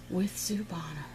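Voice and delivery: hushed whisper of her own name; whispered sign-off. Acted. Host signs off the painting therapy show, whispered.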